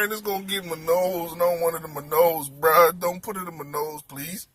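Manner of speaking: hammed-up helpless begging; mock pleading. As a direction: beg under your breath, fading out. Acts out pleading with him.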